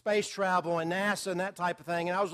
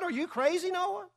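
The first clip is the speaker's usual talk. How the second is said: higher, mildly scoffing neighbor; mocking neighbors; 9 semitones higher, 4 dB quieter than usual. Neighbors taunting Noah about the ark, mock scoffing. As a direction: jeer at Noah, half in fun. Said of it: "And they said".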